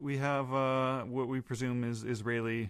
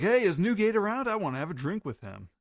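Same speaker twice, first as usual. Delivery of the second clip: much higher, loud calling out; voicing Roger; 8 semitones higher, 4 dB louder than usual. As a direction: shout across the bar for Newgate.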